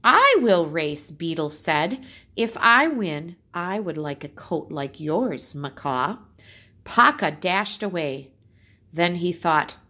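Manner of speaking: put-on lively read-aloud for children; story narration. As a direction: tell the tale to the class, bright and clear. Teacher reads folk tale aloud.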